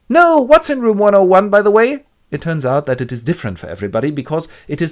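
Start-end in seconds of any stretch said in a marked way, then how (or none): 0.1-2.0 much higher, louder alarm; panicked Winston. Acted. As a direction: blurt in fear. Winston reacting.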